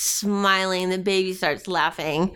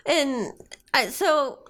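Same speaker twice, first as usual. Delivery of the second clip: normal talking voice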